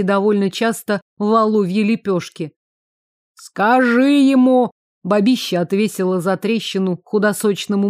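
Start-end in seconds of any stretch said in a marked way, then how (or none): 3.4-4.7 loud, slow bossy yell; angry yell. A narrator's reading. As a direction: bark the order at him. Woman yelling at her husband.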